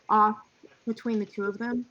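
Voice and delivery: ordinary talk, nothing marked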